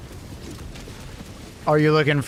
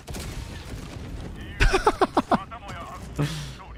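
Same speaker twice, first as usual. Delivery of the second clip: breathless laugh; 6 semitones higher, 6 dB quieter than usual. Real, not acted. After escaping.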